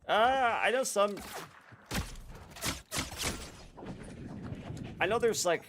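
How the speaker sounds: excited. Real, not acted. Sudden excited outburst.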